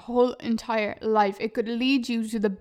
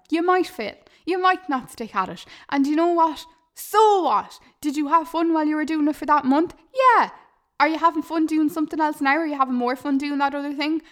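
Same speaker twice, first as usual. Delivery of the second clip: ordinary talk, nothing marked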